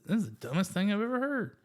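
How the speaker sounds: high, fast exasperated rant. Retold or imitated. Exasperated retort to God, high and fast. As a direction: throw it out fast in disbelief.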